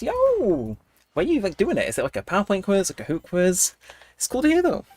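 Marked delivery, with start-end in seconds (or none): 0.0-0.8 excited. Real, not acted. Excited shout at finished quiz.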